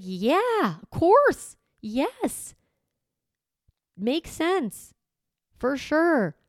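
high-pitched, wholehearted agreement; emphatic validating. Shown as a demonstration. Parent validating an angry child, emphatic and high-pitched. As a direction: agree emphatically, each phrase its own burst. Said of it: "validate it. We're like".

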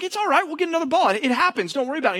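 put-on high, friendly boyish comfort; calm a worried friend; high upbeat kid voice; reassuring kids.

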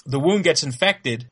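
ordinary talk, nothing marked

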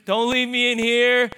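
loud, high mock childhood plea; pleading child. Sent-away child to parents downstairs, loud mock pleading. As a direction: call out like a kid shut in a room. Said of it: "what I was saying is like".

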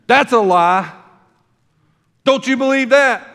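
loud, firm inner warning; Holy Spirit. Acted. The Holy Spirit warning believers when a lie is told, loud and firm. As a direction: warn them forcefully, loud and emphatic. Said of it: "the Holy Spirit dwelling within them saying".

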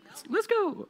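very high falsetto cartoon squeak; Mickey Mouse. Moses eagerly agreeing to go, cartoon falsetto. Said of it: "sounded like Mickey Mouse".

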